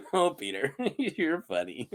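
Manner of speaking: usual speaking voice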